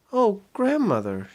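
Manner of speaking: put-on prim girl brushing him off politely; little girl voice. Minnie politely dodging Big Pete, high little girl voice.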